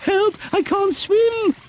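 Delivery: very high, slow, desperate; panicked cry. Acted. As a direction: she sees the river coming. Lady heading to river.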